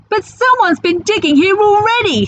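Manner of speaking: squeaky worried pirate; high pirate voice. Acted. Pirate notices the dug-up hole, high and uneasy. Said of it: "said Ben Buckle".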